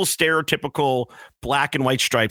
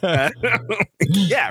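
ordinary delivery

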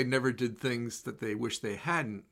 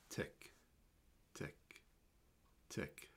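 hushed, soft tick of a clock; soft clock ticks, nearly silent; 9 semitones lower, 20 dB quieter, much slower than usual. Acted. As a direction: barely voice each tick like a clock. Soft clock ticking while writing.

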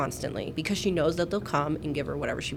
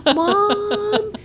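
put-on high, loud child's call; high shouted child's cry; kids calling; 5 dB louder than usual. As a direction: yell for mom like a kid. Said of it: "my kids will be in the other room going".

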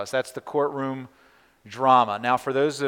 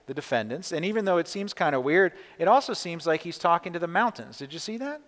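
usual speaking voice

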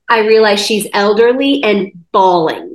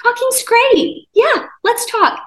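eager high voice; 13 semitones higher than usual. Retold or imitated. Answering a lonely old woman, high and gushing. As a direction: gush brightly that you love to talk.

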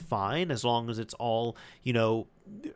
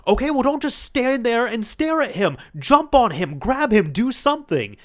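much higher, much louder yelling dad; a frustrated father shouting at his son; 11 semitones higher, 7 dB louder than usual. Retold or imitated. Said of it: "and he yells at his son".

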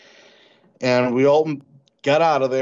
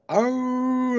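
high hooting howl, much higher; imitated howl. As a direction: mimic a coyote-like howl rising up. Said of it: "it started as a howl".

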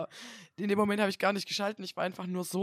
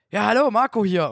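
loud, brisk caller on the phone; 3 semitones higher, 10 dB louder than usual. Retold or imitated. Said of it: "meldet sich halt eine Stimme und sagt".

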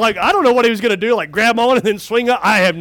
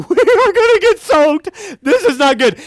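high, louder mock alarm; mock panic; 8 semitones higher, 3 dB louder than usual. Preacher recalling his panic as the whale nears, high comic alarm. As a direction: squeal it in comic dread.

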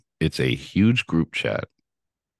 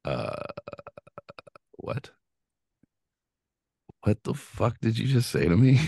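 stunned disbelief; 7 semitones higher than usual. Retold or imitated. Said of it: "I was like".

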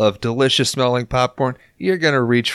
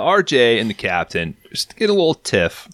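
ordinary talk

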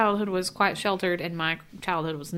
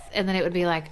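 ordinary talk, nothing marked

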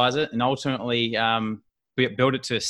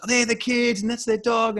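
much higher excited pointing out; imitating wife; 12 semitones higher than usual. Wife pointing out the celebrity family, high starstruck voice. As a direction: gush like a starstruck fan.

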